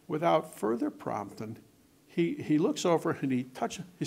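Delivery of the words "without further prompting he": ordinary talk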